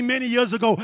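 usual speaking voice

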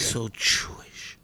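slow, drawn-out whisper; whispered joke. Acted. Co-host making a touchy joke to the host, whispered. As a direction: whisper it slowly, stretching the last word.

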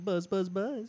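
high buzzing old-cartoon-man voice; Herbert. Retelling the cartoon mosquito grabbing a kid, high mock buzzing. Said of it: "and he's like".

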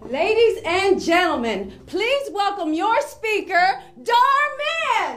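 rising, excited stage introduction; announcer. Acted. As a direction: build up to the name.

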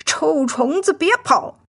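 puppy yelling, rising high on the last word; angry roar. A narrator's reading. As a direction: shout after the fleeing beetle. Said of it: "吼道". (生气地吼叫)

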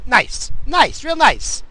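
high, loud, punched sarcasm; sarcastic approval. Real, not acted. Worker lumped in with a dummy, bitterly sarcastic. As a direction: spit out mock praise in separate bursts.

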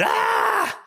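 sudden loud high yell; a big fright-giving shout; scare yell. Retold or imitated. Brother jumps out scaring him.

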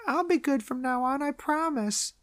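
put-on high, soft child voice; tiny high-pitched pledge; promising little boy. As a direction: imitate a sweet, earnest kid. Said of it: "Billy, uh, makes a promise to his grandpa".

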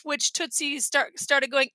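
plain reading voice

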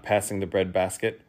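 ordinary delivery

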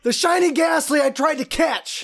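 mock angry shout. Real, not acted.

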